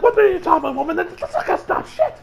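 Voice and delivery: high, loud incredulous retort; hammed-up outraged reply; mock indignant yell. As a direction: fire it back in outrage. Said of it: "she's like".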